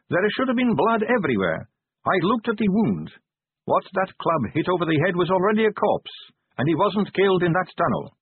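a hint of the vampire constable explaining; young officer briefs her commander, lightly voiced; lightly voiced Sally. A narrator's reading.

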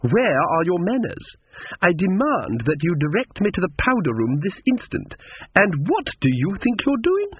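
high, indignant society-woman voice; haughty lady. A narrator's reading. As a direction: demand service imperiously, pitch soaring. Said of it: "peering haughtily".

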